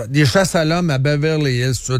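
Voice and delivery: ordinary talk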